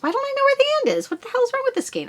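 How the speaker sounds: high, fast frustrated squeak; exasperated. Real, not acted. Hunting the skein end in a high exasperated squeak. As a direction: pitch up in annoyance.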